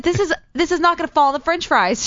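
high, insistent retort; indignant protest. Retold or imitated. Employee argues with manager about glitter on fries, indignant.

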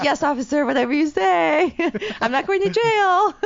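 high, eager yes-officer voice, laughing; placating the officer, high and laughing; mock submissive. Mock compliance to cop.

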